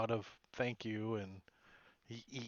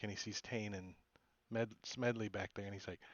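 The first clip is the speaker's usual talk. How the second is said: ordinary delivery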